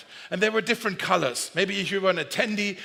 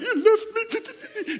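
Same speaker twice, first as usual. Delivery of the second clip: high, slow mock wail of a little boy; imitating crying child; much slower than usual. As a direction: whine it like a lost five-year-old. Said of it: "the boy was still crying".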